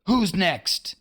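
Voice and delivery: high, demanding captain's voice; pirate captain demanding. A narrator's reading. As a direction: challenge the whole crew. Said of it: "he demanded".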